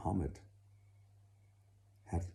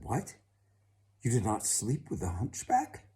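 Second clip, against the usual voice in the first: astonished father; 5 semitones higher, 4 dB louder than usual. Acted.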